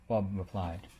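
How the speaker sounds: ordinary delivery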